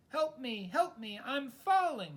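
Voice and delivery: high, loud panicked cries; trapped goose shouting to the flock; shouted. Acted. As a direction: yell for help while falling. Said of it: "shouted the goose to the flock".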